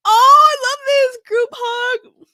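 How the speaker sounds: high, loud, drawn-out aww; very high, emotional coo; moved gushing. Real, not acted. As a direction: gush slowly over something adorable. Gushing over the group hug.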